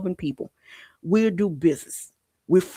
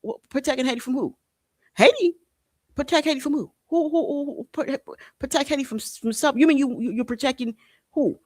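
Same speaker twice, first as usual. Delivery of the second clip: incredulous mockery; 9 semitones higher than usual. Real, not acted. Mocking the foreign claim.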